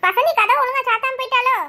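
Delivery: ordinary talk, nothing marked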